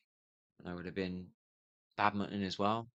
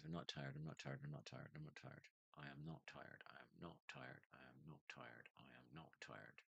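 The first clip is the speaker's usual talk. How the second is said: self-affirmation mantra; 6 semitones lower, 27 dB quieter than usual. Real, not acted. Talking himself into staying awake, soft fading mantra. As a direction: lower and much softer, repeated chant, fading away. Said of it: "Just be positive".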